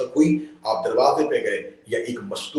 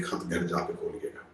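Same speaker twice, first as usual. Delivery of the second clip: a soft, secret whisper to Saeed; whispered request; 3 semitones lower, 9 dB quieter than usual. Acted. Messenger quietly urging Saeed to open it at home, whispered. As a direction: hush it like a confidential warning.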